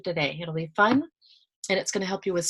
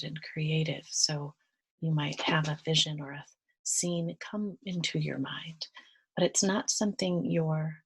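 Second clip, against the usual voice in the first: guided meditation; 7 dB quieter than usual. Real, not acted. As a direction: guide listeners gently into relaxation, unhurried and softer.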